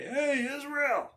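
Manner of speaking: high, jeering taunt; mock the army like a bully giant. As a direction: giant yelling insults across the valley. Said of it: "He'd say".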